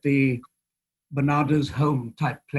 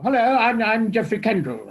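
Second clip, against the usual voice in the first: higher, louder posh English gent; Geoffrey Kendall; 5 semitones higher, 4 dB louder than usual. Retold or imitated. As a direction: play the grand old actor-manager. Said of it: "this man said".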